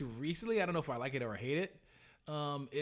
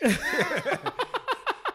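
a big burst of real laughter, pitched up; loud laugh; 8 dB louder than usual. Real, not acted. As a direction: laugh out loud at your own joke. Laughing at own joke.

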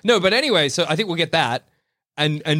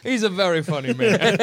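laughing; 6 semitones higher than usual. Retold or imitated. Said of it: "voicing Doc Ock laughing".

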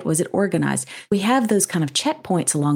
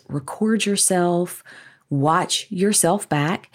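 ordinary talk

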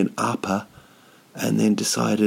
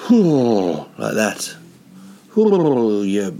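mock angry outburst; 4 dB louder, much slower than usual. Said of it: "I just sort of go".

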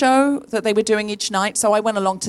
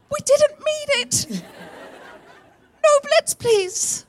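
mock plea; much slower than usual. Mock referendum plea to undo Blitz.

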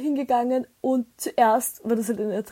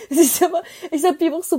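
laughing; 7 semitones higher, 6 dB louder than usual. Real, not acted.

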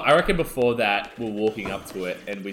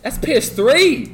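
much higher, louder, slow disbelief; surprised; 9 dB louder, much slower than usual. Real, not acted. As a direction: drag out the shock. Shocked the game is old.

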